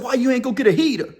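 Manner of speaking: asking the inmate why he skipped a gun, amazed; incredulous question. Retold or imitated. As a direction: fire it off fast, baffled. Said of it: "I said".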